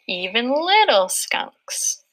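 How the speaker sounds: slow, with a small breath before "little" and before "skunks"; "Even little skunks" read slowly with a breath at each break; pausing at the dashes. Shown as a demonstration. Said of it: "so that you're taking a little breath".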